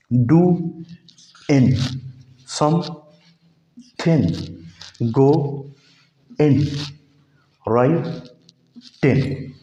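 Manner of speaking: drawn out डू इन, समथिंग, गो इन, राइट इन; -in endings. Shown as a demonstration. Teacher shows students the in ending, slowly. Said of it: "इन पे ख़त्म कर देता है".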